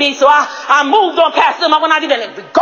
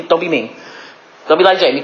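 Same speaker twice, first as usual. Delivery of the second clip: ordinary talk, nothing marked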